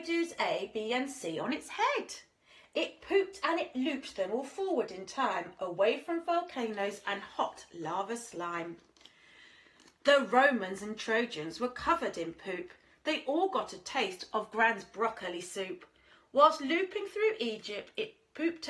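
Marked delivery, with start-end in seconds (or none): none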